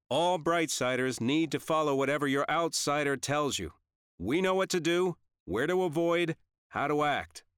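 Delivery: high, loud announcement; addressing a crowd. A narrator's reading. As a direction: project firm orders across a warehouse. Dad addresses a crowd loudly.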